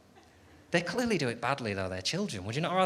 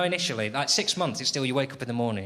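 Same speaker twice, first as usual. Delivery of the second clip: usual speaking voice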